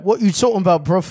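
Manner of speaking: loud, high mock London accent; hammed-up London street accent; hard London accent. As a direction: belt out an imitation Londoner. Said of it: "he'd be saying things like".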